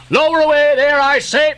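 high, thundering command; shouting. A narrator's reading. Ahab to his men across the deck, thunderous shout. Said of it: "shouting across the deck".

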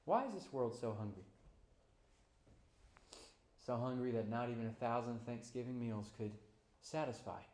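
ordinary delivery

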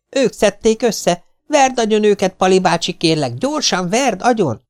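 put-on very high, loud, urgent boy's voice; furious little boy. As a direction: beg the uncle to beat them. Domi urges his uncle. (nagyon magas, hangos, követelőző kisfiúhang)

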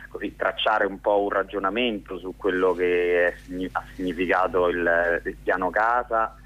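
usual speaking voice

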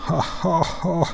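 high, spaced-out mocking ha-ha; staged pirate laugh; pirates' laughter. A narrator's reading. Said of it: "hörte man die Piraten".

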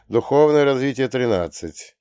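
ordinary delivery, nothing marked

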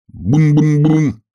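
loud, high, slow booms; drawn-out chanted drum noise; imitated drum. A narrator's reading. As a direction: boom it out like a drumbeat.

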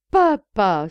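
loud, gleeful exclamation; guffawing cry. A narrator's reading. Son delirious with joy about his father, guffawing cry. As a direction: cry it out, convulsed with mirth. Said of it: "pouffant davantage".

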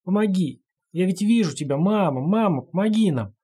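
rasping, high desperate cry for help; hoarse plea to mother; hoarse pleading. Acted. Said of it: "Голос лисички перешёл в хрип".

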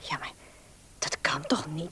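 soft, hesitant whisper; shy girl whispering her doubt. Acted. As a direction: half-whisper the objection as if tempted.

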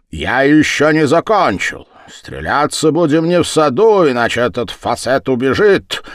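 loud, high-pitched ultimatum; heated shouting insistence; angry demand. Acted. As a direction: dictate the terms at full voice. Rival dictating duel terms angrily.

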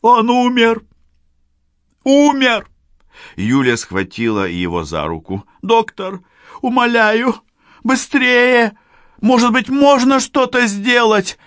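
high, loud, desperate pleading; hysterical young woman. Acted. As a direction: beg the doctor frantically. Yulia hysterical.

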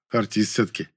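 ordinary delivery

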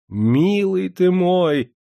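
high, long-drawn gush of joy; delighted gasp. A narrator's reading. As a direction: gush slowly at the dear messenger. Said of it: "ахнул дворянчик". (восторженно, нараспев ахнуть)

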